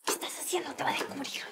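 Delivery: urgent hushed whisper. Real, not acted. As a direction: hiss the warning so nobody overhears. Secretly scolding her mother.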